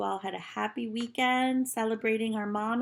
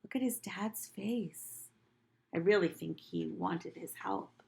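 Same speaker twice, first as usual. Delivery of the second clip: plain reading voice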